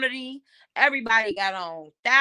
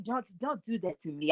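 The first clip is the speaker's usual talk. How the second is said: lightly imitated Nigerian accent, softer; Nori's Nigerian accent; 2 semitones lower, 11 dB quieter than usual. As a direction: plead in a mock Nigerian accent. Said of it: "every time I make fun of his voice".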